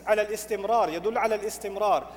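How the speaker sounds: ordinary delivery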